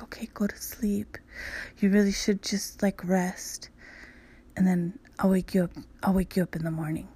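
imitating his soothing bedtime whisper; soft whisper. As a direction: whisper like putting a child to bed. Partner tucking her in.

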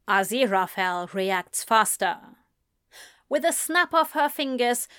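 plain reading voice

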